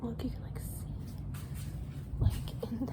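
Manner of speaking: whispering. Real, not acted. Filming inside quiet capsule hotel.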